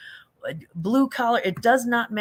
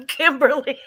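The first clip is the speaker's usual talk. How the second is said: high, loud name amid helpless laughter; laughing; 8 semitones higher, 6 dB louder than usual. Real, not acted. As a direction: laugh right through the name. Cracking up at a viewer's comment.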